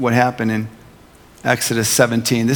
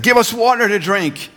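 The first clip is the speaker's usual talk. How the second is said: high, whiny demand for water; grumbling Israelites; 9 semitones higher than usual. Acted. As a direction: voice the thirsty crowd complaining. People quarrel with Moses.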